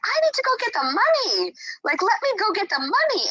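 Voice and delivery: anxious self. Retold or imitated. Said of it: "I'm like".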